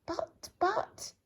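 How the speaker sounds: very high squeaky "but, but"; flustered little squeak; sputtering squeak. Acted. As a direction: splutter helplessly. Sputtering protest.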